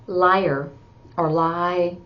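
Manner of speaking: normal talking voice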